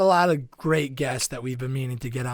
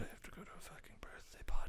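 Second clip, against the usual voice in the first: whispered grumble; hushed, fast complaint; softer, muttered under the breath; 4 semitones higher, 17 dB quieter, faster than usual. Real, not acted. Grumbling aside about party.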